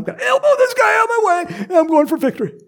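high, loud hammed-up grab for victory; greedy runner's mock shout. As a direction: yell it like shoving past a rival. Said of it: "I want this so bad".

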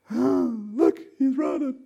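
high, mock-shocked exclamation; scandalized onlooker. Onlookers see the father running, scandalized. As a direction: point him out in high-pitched alarm.

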